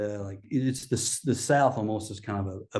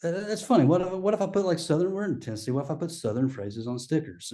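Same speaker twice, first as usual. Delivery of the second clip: excited idea; 7 semitones higher than usual. Retold or imitated. Telling his manager about the idea, high and excited. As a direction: voice jumps much higher with a sudden idea. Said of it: "so I was like".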